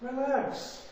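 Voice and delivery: high, airy, mock-casual relax; breezy reassurance. Moses telling the frightened people, breezy and high. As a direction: brush their fear off lightly. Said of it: "Moses says to the people".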